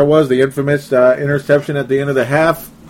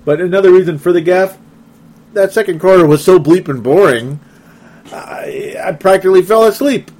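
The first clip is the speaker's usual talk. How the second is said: loud, higher exasperated rant; exasperated complaint; 6 semitones higher, 5 dB louder than usual. Real, not acted. Host admitting to listeners the quarter bored him, loud exasperated rant. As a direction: vent about how dull the quarter was.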